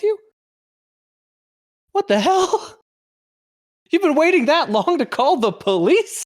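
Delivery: high, loud, incredulous; outraged disbelief. Acted. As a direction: shriek in disbelief. Mock-outraged reaction to the story.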